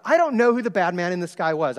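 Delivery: ordinary talk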